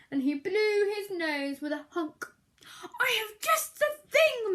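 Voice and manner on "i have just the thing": high, louder bright Mouse; announced. Acted. Mouse announcing a remedy, bright and eager. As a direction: announce it gleefully. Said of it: "Mouse said".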